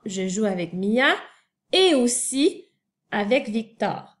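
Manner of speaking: teacher demo with et aussi pushed up; stress on et aussi. Shown as a demonstration. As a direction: punch et aussi inside the sentence. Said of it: "je te montre un exemple".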